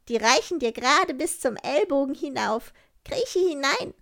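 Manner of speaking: höher und lauter, ruppig; laut und resolut beim Einpacken; forsches Räubermädchen. Acted. Räubermädchen gibt Gerda Sachen. (Räubermädchen stattet Gerda laut und resolut aus)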